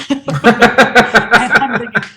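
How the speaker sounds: laughing through the words. Real, not acted. Telling of his own foolish thought, laughing as he speaks. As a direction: break into a laugh while telling it. Laughing at himself mid-story.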